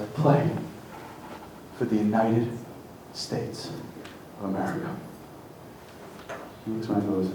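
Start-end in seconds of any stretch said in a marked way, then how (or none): 0.0-4.9 hushed, halting answer. Retold or imitated. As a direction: let each piece drop softer than the last. Player's answer at the story's climax.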